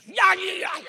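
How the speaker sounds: high, loud burst of mock yelling; retold holler. Ballplayer yelling at a batter, re-enacted holler. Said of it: "I'd holler at that batter".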